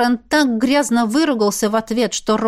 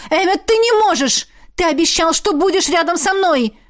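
high, desperate pleading; exclaiming; 7 semitones higher than usual. A narrator's reading. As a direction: plead with him in alarm. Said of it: "воскликнула я". (отчаянная мольба высоким голосом)

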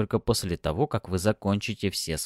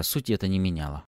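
ordinary delivery, nothing marked

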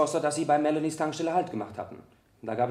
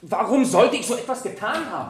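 higher, louder indignant question; outraged protest; 7 semitones higher, 4 dB louder than usual. Acted. Witness protests to the lawyer who accuses him of lying, outraged. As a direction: bristle at the accusation.

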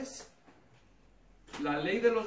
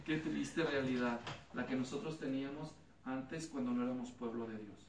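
normal talking voice

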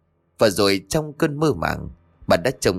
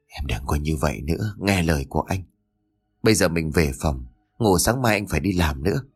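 tender comfort; 6 semitones lower than usual. Acted. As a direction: soothe her as she sobs. Husband comforting crying wife. (chồng ôm vợ, giọng trầm nhẹ an ủi)

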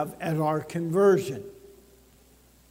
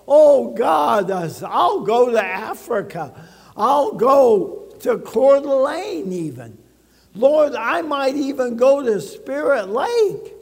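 mock emotional shout to God, higher and louder; emotional promise; 4 semitones higher, 3 dB louder than usual. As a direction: ham up a churchgoer carried away. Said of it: "you get a little emotional".

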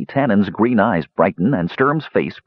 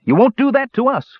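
much higher, emphatic vow; defiant vow; 4 dB louder than usual. A narrator's reading. Tannen to the boasting dwarf, defiantly vowing. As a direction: refuse it outright, pitched high. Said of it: "vowed Tannen".

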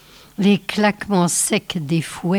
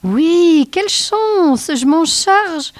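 much higher, louder eager reply; eager agreement; 10 semitones higher, 4 dB louder, slower than usual. Acted. Snow child eagerly answering the old witch to deceive her, much higher. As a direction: play the keen helper to fool the witch.

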